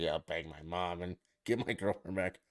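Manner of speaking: normal talking voice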